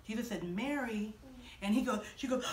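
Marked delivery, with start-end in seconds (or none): 0.4-1.1 soft, low, loving call; special, tender naming. Acted. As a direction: say the name gently. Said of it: "that's what Jesus said".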